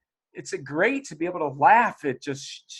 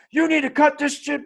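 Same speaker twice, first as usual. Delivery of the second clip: mock scold, pitched higher; scolding parent; 11 semitones higher, 3 dB louder than usual. Mother showing the harsh scolding she avoids with her teen, higher mock scold. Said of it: "But I don't do it from this".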